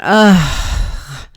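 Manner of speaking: short fed-up "ugh" through the nose; a put-upon grunt of annoyance; disgusted groan. Retold or imitated. Customer reacts to bag charge.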